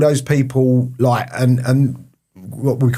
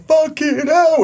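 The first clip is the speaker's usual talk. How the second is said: high, stunned exclamation in awe; a high, breathless oh my god; awed; slower than usual. Retold or imitated. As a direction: gasp it out, amazed at the sight. Awed reaction in the club.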